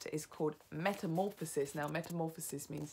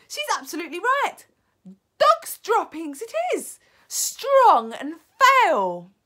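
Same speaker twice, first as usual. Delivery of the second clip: high, loud outcry, stretched at the end; calling out; 13 semitones higher, 18 dB louder than usual. A narrator's reading. Another witch confirming the stink, calling out. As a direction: call out in high, rising disgust. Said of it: "called another voice".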